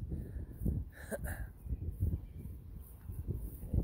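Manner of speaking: quiet breathy chuckle; a little laugh to himself; soft laugh. Real, not acted. Amused at goofy dome.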